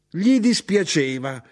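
high, indignant echo; outraged shout. A narrator's reading. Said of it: "gridò mia madre".

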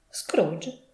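usual speaking voice

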